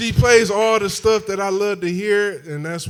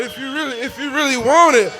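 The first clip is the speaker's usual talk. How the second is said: building hype; 7 semitones higher, 7 dB louder than usual. Real, not acted. Hyping crowd for free hats.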